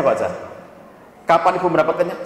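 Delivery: normal talking voice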